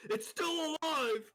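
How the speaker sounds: high, slow, drawn-out mad scientist; mad scientist cry. Acted. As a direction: cry it out like Frankenstein's maker. Mock horror at the food.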